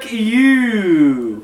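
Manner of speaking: loud, high shouted insult; loud outburst. Real, not acted. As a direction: yell it at your buddy. Playful outburst at friend.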